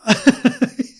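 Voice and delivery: loud, high cackle of laughter; loud laughing burst. Real, not acted. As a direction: crack up out loud. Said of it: "I can't stop laughing right now".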